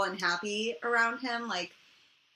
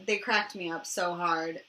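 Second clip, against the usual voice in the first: ordinary delivery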